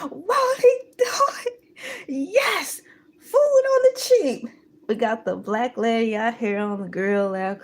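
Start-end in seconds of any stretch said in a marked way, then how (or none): 0.1-4.5 high, loud gleeful cheer; excited praise. Retold or imitated. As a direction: cheer about cheap food. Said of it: "I'm like".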